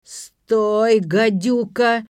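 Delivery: put-on very loud drunken bellow; yelling. A narrator's reading. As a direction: roar at the fleeing pig. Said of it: "вопль Петра".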